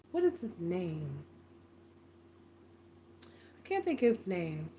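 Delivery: low, very soft thinking aloud; muttering to oneself. Real, not acted. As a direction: trail into a quiet mutter while trying to remember. Racking memory for a forgotten name.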